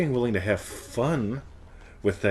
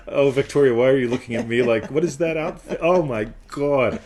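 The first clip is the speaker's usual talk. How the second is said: loud, higher playful exclaiming; mock outrage; 4 semitones higher, 7 dB louder than usual. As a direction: tease with exaggerated indignation. Acts out a mock exchange, teasing.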